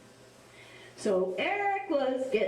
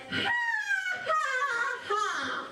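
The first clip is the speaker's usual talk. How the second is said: high, open ha ha ha; open-mouth laugh; 12 semitones higher, much slower than usual. Shown as a demonstration. Storyteller contrasting the open laugh with the closed hum.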